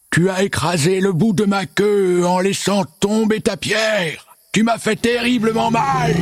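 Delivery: high, drawn-out monster roar; slow, high-pitched bellow of pain; howling monster. A narrator's reading. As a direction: howl it like a wounded, outraged monster. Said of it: "se mit à hurler".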